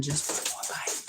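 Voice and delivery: tempting whisper; sly coaxing whisper; MSG whispering. Pretend. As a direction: whisper it like temptation in your ear. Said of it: "M S G whispering".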